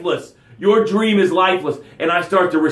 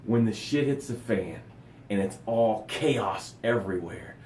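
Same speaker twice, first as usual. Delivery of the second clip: lower, softer meditative guidance; calm guidance; 7 semitones lower, 9 dB quieter than usual. Acted. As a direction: guide them down into calm. Calm guided breathing talk.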